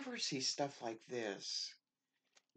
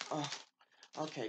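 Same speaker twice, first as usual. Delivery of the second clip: ordinary talk, nothing marked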